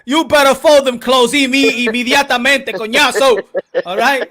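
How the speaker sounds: loud mock scolding. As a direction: scold him, half laughing. Mock scolding friend loudly.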